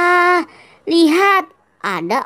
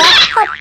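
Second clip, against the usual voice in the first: jump grunt; 7 semitones higher, 6 dB louder than usual. Acted. Jumps to grab clown head.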